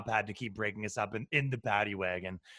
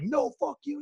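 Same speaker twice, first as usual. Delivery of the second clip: high-pitched mock defiance; imitated angry yelling. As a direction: act out a protester's shrill refusal, not full volume. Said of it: "He's yelling and screaming".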